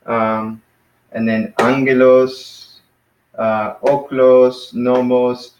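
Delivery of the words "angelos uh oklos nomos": "angelos, oklos, nomos", each stressed on its first syllable. Shown as a demonstration. Teacher drilling words accented on the first syllable, front-stressed. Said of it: "accent on the first syllable".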